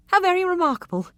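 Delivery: high, sarcastic wonder; mock amazement. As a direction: feign being amazed.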